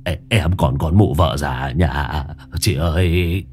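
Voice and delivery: low, stammering plea for mercy; terrified begging. A narrator's reading. Old man begs the ghost, terrified and stammering. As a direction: stutter and beg in terror. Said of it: "chắp tay lạy như tế sao".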